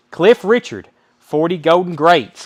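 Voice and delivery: booming auction call; loud announcement. Real, not acted. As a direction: call the lot out to the room. Auctioneer announcing next record.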